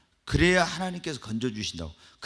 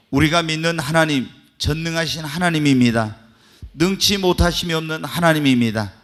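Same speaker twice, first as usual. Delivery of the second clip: full-force preaching; 8 dB louder than usual. Acted. Pastor exhorting the church about thanksgiving, full-force preaching. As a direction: drive each line home to the congregation.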